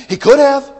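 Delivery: loud, high preaching; fiery preaching. Acted. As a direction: drive it home from the pulpit. Preaching at full force.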